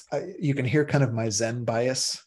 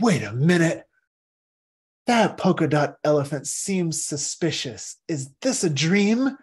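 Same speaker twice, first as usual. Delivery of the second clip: mock suspicion; 4 semitones higher, 3 dB louder than usual. Dreamer suspecting a dream.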